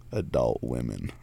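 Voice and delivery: low, slow, meaningful reveal; knowing shop owner. Retold or imitated. Shop owner explaining cougars to the driver, low and slow.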